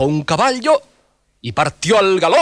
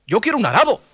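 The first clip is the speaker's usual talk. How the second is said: peasant voice; 8 semitones higher than usual. Acted. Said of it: "dijo un campesino".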